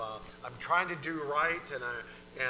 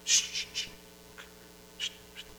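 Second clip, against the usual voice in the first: calming shushes. Acted. Lord calming their spirits, gentle repeated shushing. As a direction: hush them like a fretful child. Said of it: "like, calming their spirits".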